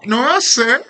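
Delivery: laughing outburst; laughing cry. Real, not acted. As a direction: squeal it out laughing.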